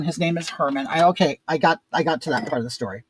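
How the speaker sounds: ordinary talk, nothing marked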